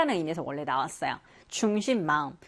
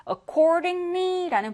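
Accordingly drawn out slowly, pitched up; slow drawn-out word; 10 semitones higher, much slower than usual. Shown as a demonstration. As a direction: lay out Accordingly syllable by syllable. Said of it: "stretches a new word". (길게 늘여 읽는 Accordingly)

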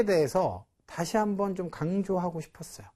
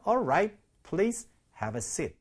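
slow model reading; 3 semitones higher, much slower than usual. Shown as a demonstration. 뭐라고 했어요?.

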